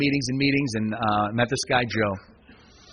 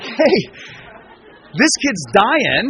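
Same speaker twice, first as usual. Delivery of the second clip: higher and louder, slowing right down on dying; urgent alarm; 6 semitones higher, 6 dB louder, much slower than usual. Retold or imitated. Sponsor Joe realising the newcomer is dying, urgent and loud. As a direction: sound the alarm about the kid, pressing hard.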